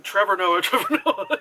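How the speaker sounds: badly faked South African accent with laughter; attempted South African accent. Reviewer grasping for the witch's accent, laughing mock South African.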